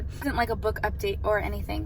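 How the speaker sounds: ordinary talk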